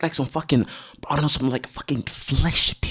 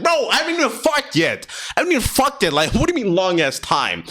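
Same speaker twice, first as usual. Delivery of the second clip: higher, indignant retort; mock annoyed protest; 9 semitones higher than usual. Snapping back at friends rushing him, higher and indignant. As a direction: push back like you're fed up. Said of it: "Bro, I was getting mad".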